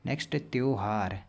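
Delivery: ordinary talk, nothing marked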